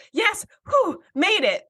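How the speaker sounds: high, puffed "just made it" act; badly faked breathlessness after a dash; mock out of breath. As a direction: imitate someone pretending to be winded. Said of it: "tried to act out of breath".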